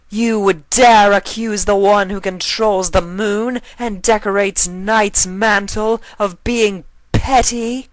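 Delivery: roaring. Acted. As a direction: bellow down at the insolent queen. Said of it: "she roared".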